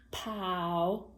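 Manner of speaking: "powder" broken into POW, pause, DER; split into syllables. Shown as a demonstration. Teacher dictating a spelling word, "powder" split slowly into two syllables. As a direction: stretch and separate both syllables of "powder". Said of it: "make a two-syllable word".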